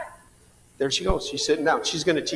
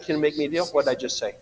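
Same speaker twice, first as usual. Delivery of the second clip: normal talking voice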